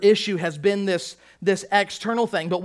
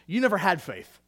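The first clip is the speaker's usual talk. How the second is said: ordinary talk, nothing marked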